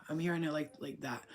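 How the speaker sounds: ordinary talk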